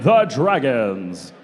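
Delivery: low, slow, drawn-out name reveal; fighting game announcer. Acted. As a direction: stretch the team name for the crowd.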